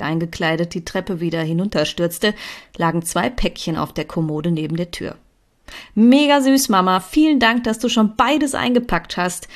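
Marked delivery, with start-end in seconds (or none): 5.9-9.5 high, loud, delighted thanks; called out gratefully. A narrator's reading. Thanking mother for wrapping both presents, calling out. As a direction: gush thanks while rushing past. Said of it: "rief ich".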